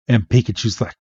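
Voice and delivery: ordinary delivery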